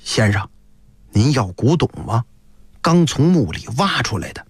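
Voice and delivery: solemn, low-pitched furtive pitch; low, grave voice. Acted. Stranger sidling up to offer tomb antiques, low and solemn. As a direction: drop the voice low and serious. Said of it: "神情严肃， 语调低沉".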